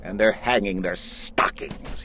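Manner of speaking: hushed, sneering whisper; snarled whisper. Acted. Grinch sneering at the Whos, whispered. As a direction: breathe it out with a sneer. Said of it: "he snarled with a sneer".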